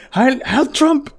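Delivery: high, squealing mock fanboy cry, slow on the second; mocking Musk. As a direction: ham up a giddy, drugged-out Musk. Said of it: "it's like".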